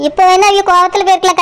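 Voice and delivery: ordinary talk, nothing marked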